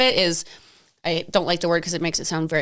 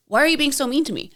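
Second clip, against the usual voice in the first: mimicked wounded complaint; 6 semitones higher, faster than usual. Mimics the bullying officer's complaint.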